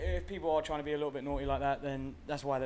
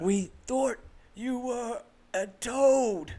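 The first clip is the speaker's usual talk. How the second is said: higher, slow Southern American voice; American accent movie quote; 10 semitones higher, 8 dB louder, much slower than usual. Retold or imitated. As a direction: drag it out in a Southern twang. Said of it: "I'm busting out "Oh Brother, Where Art Thou" quotes".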